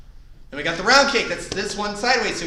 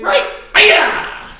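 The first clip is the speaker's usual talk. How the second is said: high kiai yell that cracks; 13 semitones higher, 9 dB louder than usual. Real, not acted. Instructor's kiai for the class cracking mid-yell. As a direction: let the shout break at the top. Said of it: "That was a great voice crack".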